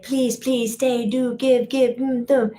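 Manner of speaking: a hammed-up plea to stay; begging friends. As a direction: drag out the begging. Imitating friends begging her to stay.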